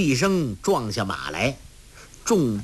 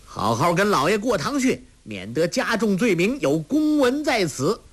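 higher, official and pompous; calling out; 8 semitones higher than usual. Acted. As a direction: call out a warning. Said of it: "另一名武官叫道".

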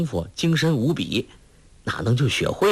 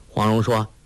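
usual speaking voice

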